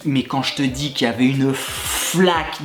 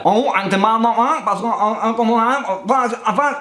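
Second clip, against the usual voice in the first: imitating a Chinese lady's accent, higher; a lightly hammed Chinese accent, pitched up; mock Chinese accent; 7 semitones higher than usual. Imitating a Chinese lady at the gym desk in a mock Chinese accent. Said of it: "je vais essayer de prendre l'accent un petit peu asiatique".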